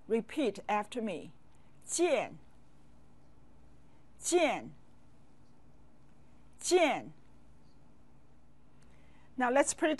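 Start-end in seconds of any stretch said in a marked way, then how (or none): 1.8-2.4 见 in a sharply falling fourth tone; clear fourth tone. Shown as a demonstration. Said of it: "Say the fourth tone clearly". 4.2-4.7 见 in a sharply falling fourth tone; clear fourth tone. Shown as a demonstration. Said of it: "Say the fourth tone clearly". 6.6-7.1 见 in a sharply falling fourth tone; clear fourth tone. Shown as a demonstration. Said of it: "Say the fourth tone clearly".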